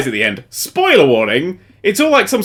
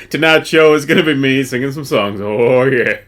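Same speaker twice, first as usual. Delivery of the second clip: low, smug cartoon hunk; a touch of a lower Johnny Bravo croon; 5 semitones lower than usual. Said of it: "That was Johnny Bravo to finish".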